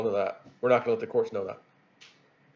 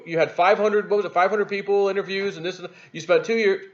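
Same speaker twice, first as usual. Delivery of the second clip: normal talking voice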